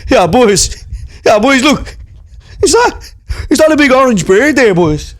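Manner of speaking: put-on lairy young lad in tracksuit; lad at a gaff. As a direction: call out to the boys, cocky. Sketch, lads at a house party.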